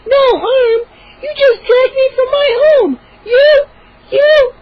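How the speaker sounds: very high, loud tearful yell; furious tearful shout. Acted. Mindy screaming at the gnomes. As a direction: scream at them in outrage.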